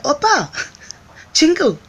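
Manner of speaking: sweet mock greeting. Greeting the fighter guy in cutesy Korean. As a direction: call out cute and bright. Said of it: "I was just like".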